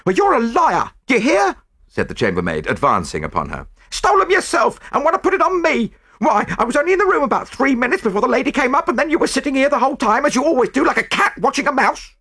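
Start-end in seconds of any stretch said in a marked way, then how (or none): 0.0-1.6 loud, high-pitched quarrelling woman; angry accusation. A narrator's reading. Chambermaid advances on her accuser, angry. 3.9-12.1 high, loud, rapid accusing rant; angry tirade. A narrator's reading. Chambermaid accuses the French maid back, angry.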